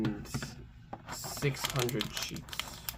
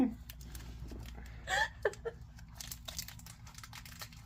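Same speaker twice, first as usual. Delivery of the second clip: long breathless wheezing laugh; can't stop laughing; laughing fit; 12 dB quieter than usual. Real, not acted. Long laugh while opening.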